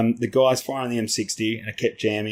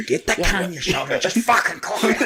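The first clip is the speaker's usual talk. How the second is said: high, strained urgent command; hammed-up exasperated order; mock urgent order; 7 semitones higher than usual. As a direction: re-enact frantic instructions with pitch climbing. Retold urgent order on set.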